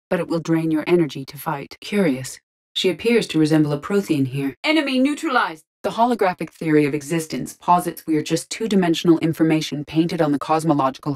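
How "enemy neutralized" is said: high-pitched battle call; combat kill report. Acted. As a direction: report it briskly to the squad.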